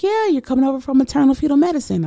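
high, quick receptionist voice; imitated hospital staff. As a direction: mimic an efficient front desk in a high pitch. Hospital staff greeting her, voiced.

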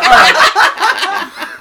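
high, loud laughing all right; laughing dismissal. Retold or imitated. Partiers laughing off the neighbor, loud high laugh. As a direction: laugh him off. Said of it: "We're like".

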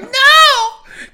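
high, loud protesting cry; mock yelled refusal. Telling the cat off in a story, high mock yell. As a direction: shoot way up in pitch, acting appalled.

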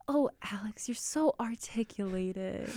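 mock-amazed, drawn-out praise; condescending praise. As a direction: imitate someone impressed in a condescending way. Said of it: "it's always with that, like, tone".

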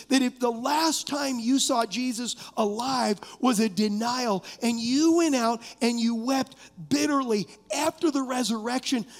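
ordinary talk, nothing marked

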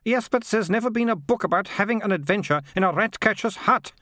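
high-pitched, understated shouted protest; mild shout. A narrator's reading. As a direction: protest as a flustered girl, a touch shouted. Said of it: "Militia shouted".

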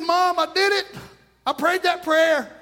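lightly voiced kid pleading his innocence; protesting little boy. Boy to mom, blaming the devil, earnest protest. Acts boy's excuse to his mother.

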